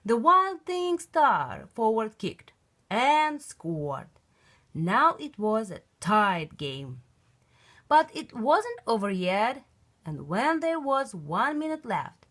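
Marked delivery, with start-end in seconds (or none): none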